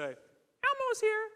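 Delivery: very high, drawn-out puppet falsetto; Elmo. Retold or imitated. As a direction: squeak it like a children's show puppet. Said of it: "And I'd say".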